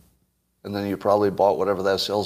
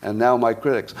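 ordinary talk

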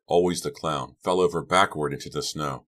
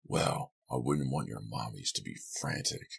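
lightly mocking, low and soft; a hint of baby talk, kept low; mock babyish voice; 5 semitones lower, 8 dB quieter than usual. A narrator's reading. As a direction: tease them in a hushed little voice. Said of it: "in a babyish little voice".

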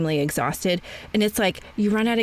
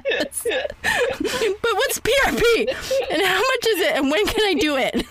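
laughing eagerly; 11 semitones higher than usual. Real, not acted. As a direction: giggle while firing off eager questions. Laughing, mocking own eagerness.

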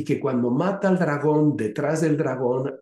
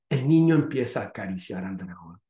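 usual speaking voice

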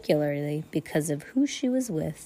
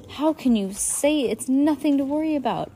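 agitated; 8 semitones higher, 3 dB louder than usual. A narrator's reading. Poe arguing with Stefano.

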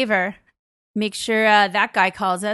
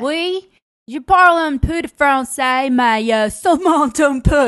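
higher, louder hammed-up French accent; 6 semitones higher, 10 dB louder than usual. Shown as a demonstration. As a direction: perform the French accent on request. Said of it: "Do you, do that".

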